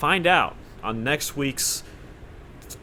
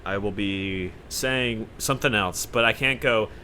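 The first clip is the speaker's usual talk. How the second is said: normal talking voice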